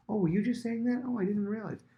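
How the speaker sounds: high, mock-startled apology; feigned surprise. Nathan to Bathsheba, high mock surprise. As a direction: act surprised you interrupted.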